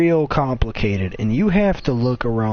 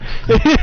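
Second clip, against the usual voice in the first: loud laugh; 12 semitones higher, 6 dB louder than usual. Real, not acted. Laughing while retelling the rant.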